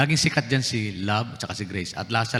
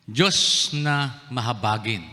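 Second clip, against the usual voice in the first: very slow emphasis; much slower than usual. Real, not acted. Preacher stressing God's mercy.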